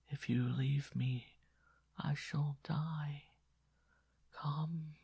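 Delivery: put-on dying boy begging the nun, whispered; faint far-away whisper. A narrator's reading. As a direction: beg her in a dying whisper. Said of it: "his voice was now quiet, far, far away".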